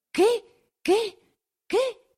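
very high, short cries of disbelief; shocked questions. A narrator's reading. Villagers hearing of armed men, high shocked questions. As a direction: squeak each question far above normal pitch. Crowd reacts to alarming news.

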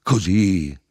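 loud, high single-word cry; shouting. A narrator's reading. Narrator shows the two men his kneeling, shouting. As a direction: shout it out in one burst. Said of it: "gli gritai".

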